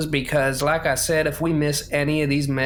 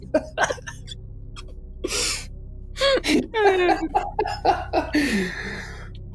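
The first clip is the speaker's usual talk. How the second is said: silent wheezing laugh. Real, not acted. Long laughing fit.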